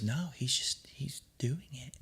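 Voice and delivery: hushed, nearly whispered amazement; hushed reply. Retold or imitated. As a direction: drop to a near-whisper, imitating the mom. Mom's hushed reply as boy cries.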